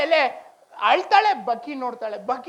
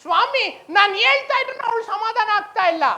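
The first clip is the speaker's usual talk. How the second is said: acted complaint; 7 semitones higher, 3 dB louder than usual. Angel reports helplessly to the Lord.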